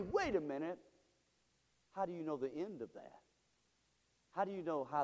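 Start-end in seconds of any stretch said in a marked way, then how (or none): none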